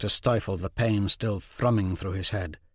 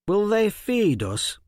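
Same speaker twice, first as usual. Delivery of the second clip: put-on high girl voice; 11 semitones higher, 4 dB louder, slower than usual. Hungry girl asks in tent.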